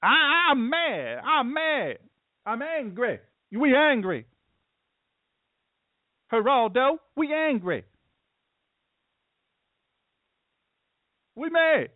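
high-pitched mock protester whine; mad protester. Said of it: "What are you mad at?".

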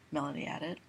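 ordinary delivery, nothing marked

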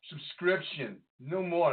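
ordinary talk, nothing marked

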